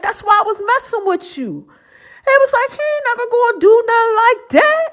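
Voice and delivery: high mock voice. Quoting her accountability partner, in a high mock voice. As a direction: pitch the friend's lines up high. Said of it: "He's like".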